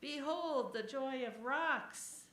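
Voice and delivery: slow, loud and lofty; grand announcement. Acted. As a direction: proclaim it like a herald. Grand proclamation.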